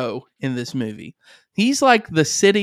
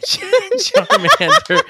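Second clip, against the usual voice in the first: squealing high through laughter; can barely get the name out for laughing; slower than usual. Real, not acted.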